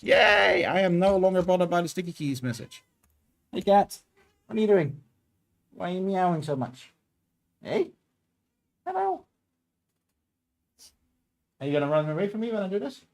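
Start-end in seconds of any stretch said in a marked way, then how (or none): 3.5-13.0 high sing-song voice for the cat; cat talk. Real, not acted. Talking sweetly to her cat.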